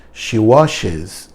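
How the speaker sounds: "washes" said slowly, ending on a buzzed z; washes with z sound. Shown as a demonstration. Teacher showing students the z sound in "washes". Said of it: "É um Zzinho".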